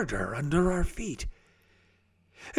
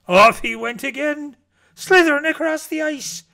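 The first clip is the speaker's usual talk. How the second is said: excited; 10 semitones higher, 6 dB louder than usual. A narrator's reading. Jackson slides away.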